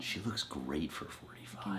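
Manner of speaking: slow soft whisper. Real, not acted. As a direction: whisper it as an aside. Quiet aside while watching clip.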